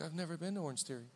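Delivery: soft, quick throwaway aside; mumbled joke reply. Acted. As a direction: toss it off under your breath. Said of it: "He's like".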